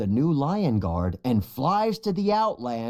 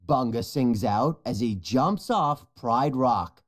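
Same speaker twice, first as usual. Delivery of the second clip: plain reading voice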